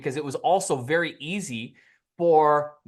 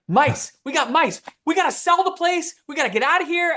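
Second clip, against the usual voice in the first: mock panic, much higher and louder; imitating panicked wife; 12 semitones higher, 4 dB louder than usual. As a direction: play the wife's alarm, hammed up. Wife panicking about mice at night.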